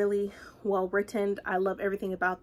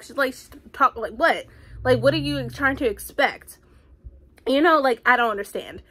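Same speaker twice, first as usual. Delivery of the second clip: still indignant; 4 semitones higher, 6 dB louder than usual. Real, not acted. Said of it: "I got so pissed".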